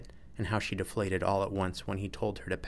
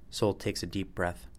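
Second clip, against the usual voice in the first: usual speaking voice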